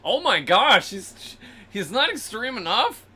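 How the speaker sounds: hammed-up horrified viewer; mock shock. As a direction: imitate scandalized listeners. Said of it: "Everybody's, like, Googling".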